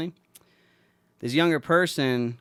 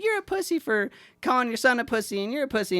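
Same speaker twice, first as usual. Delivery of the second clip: high-pitched mocking kid's taunt; 11 semitones higher than usual. Kid taunting a man who teased his stepson, mock taunting. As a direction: imitate a kid throwing an insult back. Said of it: "I know how he taunts".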